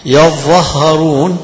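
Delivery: يظهرون slow, with a doubled ẓ and a long ā; the teacher reciting the third reading of يظهرون; third reading demo. Shown as a demonstration. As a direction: stretch يظهرون as yaẓẓahharūn. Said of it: "ثلاث قراءات فيها".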